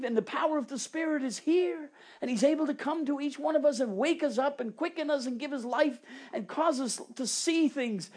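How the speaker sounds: rising revival preaching; fervent preaching. Acted. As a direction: lift the congregation with passion. Preaching at full force.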